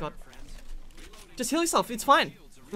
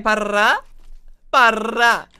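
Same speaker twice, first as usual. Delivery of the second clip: loud, rolled "Barra" as gunfire; imitated gun bursts; 10 dB louder, much slower than usual. Shown as a demonstration. Player correcting how the gun sounds, loud imitated bursts. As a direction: roll out each "barra" loudly, a pause between. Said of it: "you could be like".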